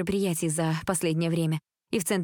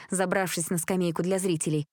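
ordinary delivery

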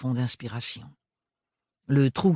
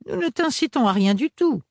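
quick, higher, louder rush to deny; hasty denial; 12 semitones higher, 5 dB louder than usual. A narrator's reading. Rapporteuse hastily cutting off the mother, eager denial. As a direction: cut in before anyone can speak. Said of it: "s'empressa d'affirmer la rapporteuse".

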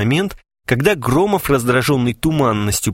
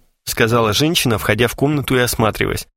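plain reading voice

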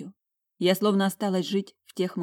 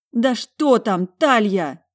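loud, raised demand; panicked roar; 5 semitones higher, 8 dB louder, much slower than usual. A narrator's reading. Roaring at her sister at the door, panicking. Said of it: "взревела я, впадая в панику".

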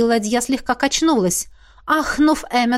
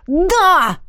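exclaim; 8 semitones higher, 5 dB louder than usual. A narrator's reading. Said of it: "воскликнул Эммет".

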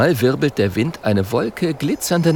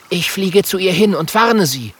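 raised character voice, resolute; high, plucky young hero; determined little dragon; 9 semitones higher than usual. A narrator's reading. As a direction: announce your plan in a high voice.

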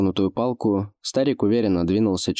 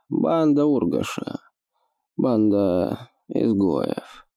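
put-on lisping old orc; 3 semitones higher than usual. Old orc explains slowly.

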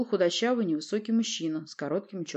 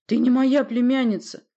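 loud, higher-pitched protest; indignant denial; 6 semitones higher, 8 dB louder than usual. A narrator's reading. Prince disowns the claimed niece, indignant. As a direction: deny it outright, outraged. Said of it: "возмутился Порти".

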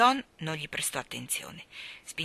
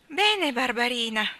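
high, drawn-out coaxing call; wheedling; 6 semitones higher, 6 dB louder, slower than usual. A narrator's reading. Starling coaxing little Barbara, wheedling. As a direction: sweet-talk the baby, slowly and brightly. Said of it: "cominciò con la sua voce lusinghiera".

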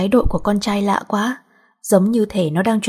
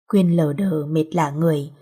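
plain reading voice

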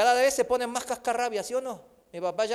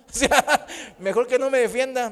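laughing; 3 semitones higher, 4 dB louder than usual. Real, not acted. Laughing at own story.

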